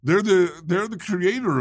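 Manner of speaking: high, mocking voice; mock outrage. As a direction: sneer it like a conspiracy believer. Mocking opponents' claim.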